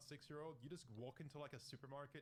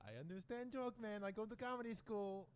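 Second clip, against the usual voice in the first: broken-English character; 7 semitones higher, 3 dB louder than usual. Pretend.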